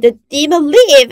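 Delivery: high, loud sharp command; cried out command. Retold or imitated. Pastor running up stairs ordering the demon out, loud cry. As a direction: bark it at the demon while running. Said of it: "I cried".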